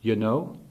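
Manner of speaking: "you know" rising like a question; questioning tag. Retold or imitated. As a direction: lift the end of "you know" to seek a response. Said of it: "like there's an unspoken".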